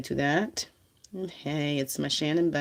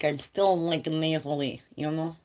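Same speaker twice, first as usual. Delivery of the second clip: ordinary talk, nothing marked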